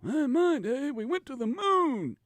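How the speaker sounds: high-pitched old-timer voice, hammed-up; old man. Host mocking his own old-man nostalgia, high hammed-up voice. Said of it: "an old man, you know".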